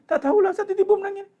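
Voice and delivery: high, plaintive student voice; whiny student. Retold or imitated. Student asking the ustaz.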